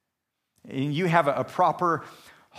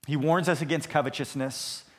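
ordinary talk

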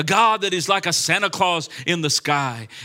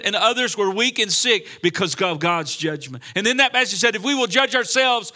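raised, driving sermon delivery; forceful preaching; 4 semitones higher, 4 dB louder than usual. Real, not acted. Preacher warns church of God's judgment in Corinth, forceful preaching. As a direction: preach it hard, punching the key words.

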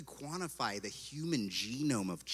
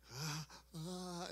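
choked sob; 8 semitones higher, 7 dB quieter than usual. Retold or imitated. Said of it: "it, like, leaks out of my eye".

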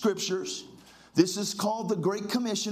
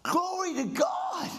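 exultant shout; 9 semitones higher, slower than usual. Real, not acted. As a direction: burst out high, praising God. Preacher erupting in praise.

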